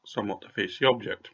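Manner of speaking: ordinary delivery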